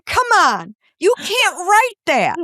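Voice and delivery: usual speaking voice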